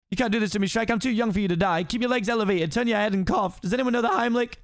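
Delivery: high-pitched comic panic; frantic donkey. Acted. Donkey fussing over wounded friend, frantic and high. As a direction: rattle off useless first aid in alarm.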